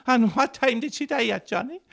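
high, prying village gossip; nosy mourner. Acted. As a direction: ask it like a curious neighbour. Mourner questioning widower at wake.

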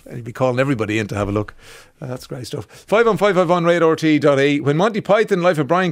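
normal talking voice

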